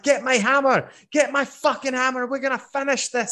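high, angry roar; furious dwarf. Acted. GM voices the furious dwarf demanding his hammer, high angry yell. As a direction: rage like a dwarf just knocked out. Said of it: "Dirk comes around and he's furious".

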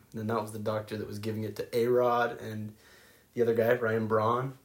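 ordinary delivery

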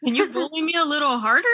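loud, high-pitched playful begging; mock plea. Friend asking to be bullied harder, loud mock plea. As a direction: plead loudly, as a joke.

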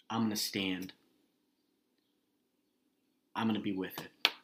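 ordinary delivery

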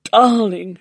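loud, high, drawn-out gushing; delighted cry. A narrator's reading. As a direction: coo loudly over an adored pet. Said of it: "she cried".

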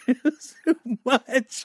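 high, tearful laugh-cry; choked up and laughing. Real, not acted. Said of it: "I'm tearing up".

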